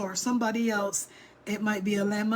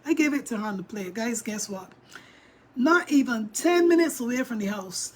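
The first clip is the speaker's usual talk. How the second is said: ordinary delivery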